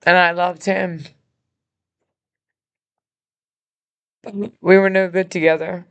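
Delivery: fighting back real tears; emotional. Real, not acted. Admitting to viewers she loved her abuser, choked up. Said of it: "Sorry, I'm emotional".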